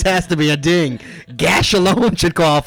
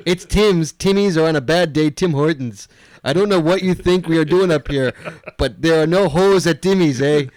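mild put-on Canadian accent, a bit hammy. Reading a Canadian listener's email to cohost, mock Canadian accent. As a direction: read the email like a joking Canadian. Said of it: "your Canadian accent's worse than mine".

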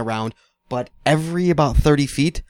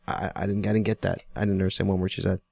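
low, hushed, baffled aside; confused aside; 6 semitones lower, 7 dB quieter than usual. Retold or imitated. Diner confiding to his girlfriend about the waitress, hushed and baffled. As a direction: lean in and quietly admit confusion.

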